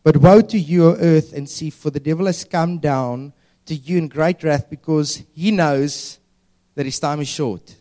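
loud, declaimed Bible verse; scripture reading. Acted. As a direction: proclaim the verse to a congregation. Reads scripture aloud.

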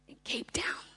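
soft unvoiced whisper. Real, not acted. Speaker whispers the city to the audience, unvoiced. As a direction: whisper the place like a secret.

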